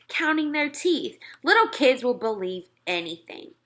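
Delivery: usual speaking voice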